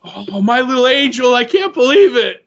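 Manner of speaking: hammed-up motherly disbelief; disillusioned mother. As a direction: lament your fallen little angel. Mother voiced, dismayed at her angel.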